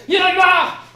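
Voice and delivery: very high, very loud outburst; shout. Retold or imitated. Husband fed up with the news, shouting. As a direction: yell it out fed up. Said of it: "Il avait crié".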